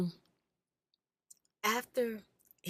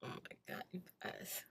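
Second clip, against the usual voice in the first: far softer, a voiceless whisper; whispered apology; 10 dB quieter than usual. Real, not acted. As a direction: hush down to almost nothing, embarrassed. Apologizing, overwhelmed, to viewers.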